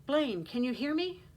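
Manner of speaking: urgent but keeping control; alarmed, controlled. A narrator's reading. Aunt shaking Blaine's arm, alarmed but controlled. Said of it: "Her tone had regained control, alarmed but no longer panicked".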